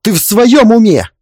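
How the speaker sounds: high, loud, incredulous outburst; sharp scolding shout. Acted. Husband scolding his wife for accusing father, angry shout. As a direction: raise the voice in outraged disbelief. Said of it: "прикрикнул муж".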